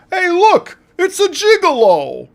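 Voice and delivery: put-on loud, high-pitched heckle from an old man; old man's yell. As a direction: blurt it out far too loud, like an oblivious old man. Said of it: "hearing an old man yell".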